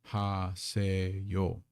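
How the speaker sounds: Ha se yo said slowly, one syllable at a time. Shown as a demonstration. Teacher breaking a greeting into syllables, slow and careful. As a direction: spell out Ha se yo for the class, slowly. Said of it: "An nyang".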